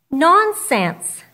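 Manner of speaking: emphatic nonsense. Acted. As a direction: brush her doubt away forcefully. Jesus dismisses her doubt.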